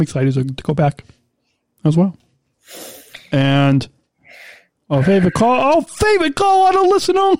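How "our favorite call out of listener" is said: playful high-pitched announcing. Pretend. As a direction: jump up into a high silly voice. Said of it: "that's like a high-pitched voice".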